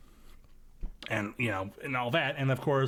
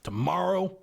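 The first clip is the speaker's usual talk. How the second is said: Southern gentleman; 7 semitones higher than usual. Acted. Playing a blustering Southern gent for the host, high and pompous. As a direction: challenge her to a duel with mock outrage. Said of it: "I'm getting a Foghorn Leghorn vibe".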